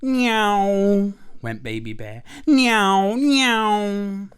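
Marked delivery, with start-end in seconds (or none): 0.0-1.1 loud, high swooping plane sound; child's drawn-out "neeyow" plane; aeroplane noise. Acted. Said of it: "pretending to be an aeroplane". 2.5-4.3 loud, high swooping plane sound; child's drawn-out "neeyow" plane; aeroplane noise. Acted. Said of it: "pretending to be an aeroplane".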